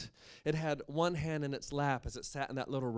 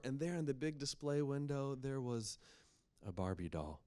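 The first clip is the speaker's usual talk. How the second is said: plain reading voice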